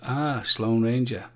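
ordinary talk, nothing marked

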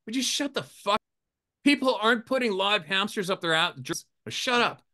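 high-pitched fed-up snap; mock annoyed. Telling off his buddies, a high fed-up snap. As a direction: brush off the silly rumour in annoyance. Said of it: "I go".

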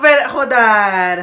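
slow, loud "hold on"; stretched loud hold on; loud plea. Real, not acted. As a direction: shout for everyone to wait. Overwhelmed fan pleading.

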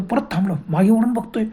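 usual speaking voice

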